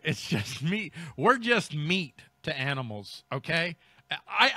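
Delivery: ordinary talk, nothing marked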